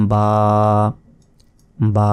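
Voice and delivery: বা with the vowel held for about a second; recitation-style long aa in বা; one-second stretch. Shown as a demonstration. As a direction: draw out বা evenly on a level pitch. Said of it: "এক second আমি টেনে পড়লাম".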